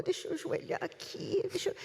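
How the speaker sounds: mock whispered plea. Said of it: "pensa assim".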